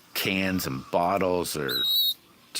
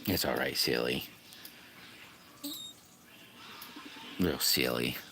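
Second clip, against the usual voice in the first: soft, low soothing to a little bird; calm the scared bird gently; 6 semitones lower, 10 dB quieter than usual. Real, not acted. Streamer soothes the baby bird, soft and low.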